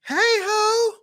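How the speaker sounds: put-on squealed very high, like a girl spotting her friend; girls greeting each other. Imitating girls greeting each other in a very high squeal. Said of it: "A lot of girls talk like this".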